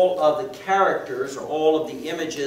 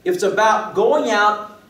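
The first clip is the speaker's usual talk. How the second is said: higher, louder proclamation; fervent preaching; 6 semitones higher, 5 dB louder than usual. Acted. Preacher proclaiming the lost are sought, fervent. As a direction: proclaim it with rising passion.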